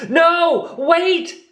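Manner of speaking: very high urgent cry. Acted. Roo stops the hunters, high urgent cry. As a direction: stop them before they charge. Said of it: "cried Roo".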